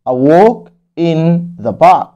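A walk in the park read out very slowly with a rise. Shown as a demonstration. Teacher repeating the story title slowly. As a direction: model it slowly again.

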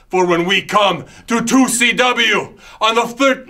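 booming villain proclaiming to infidels; megalomaniac rant. Acted. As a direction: preach your threats like a fanatic leader. Character promo rant.

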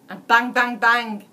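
very loud gunshot shouts; shouted bangs. A narrator's reading. Narrator shouting Red's pistol shots. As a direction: shout each bang like a shot.

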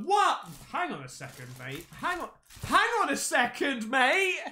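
usual speaking voice

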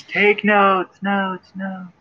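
high mock call echoing and fading away; playful fake echo, dying off; voice in the distance. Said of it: "Suddenly a voice in the distance".

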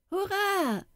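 put-on high, cheering hurrah from a gang of boys. A narrator's reading. Street boys cheering on the fight, excited hurrah. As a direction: shout it like kids at a street fight. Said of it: "riefen die Knaben".

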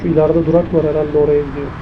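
ordinary talk, nothing marked

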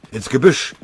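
laut und höher gebrüllt; brüll den Befehl über den Lärm; brüllend. Acted. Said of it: "brüllte Oberfeldwebel Fischer". (lautes, höheres Gebrüll)

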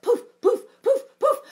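high, loud popping poofs; magic poofs. Acted. Storyteller wishing unicorns away, high popping poofs. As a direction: pop each one away like magic.

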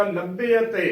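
ਲੱਭੇ ਅਤੇ as labbe-a-te, middle vowels both sounded; correct pronunciation. Shown as a demonstration. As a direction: open it out into labbe-a-te. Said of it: "ਇਹਦਾ ਸ਼ੁੱਧ ਉਚਾਰਨ ਹੋਵੇਗਾ".

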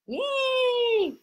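long, high, held "yay"; high cheer. Real, not acted. Teacher cheering learners after the drill, high drawn-out cheer. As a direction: draw out a high squeal of celebration.

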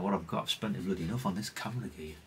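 ordinary delivery

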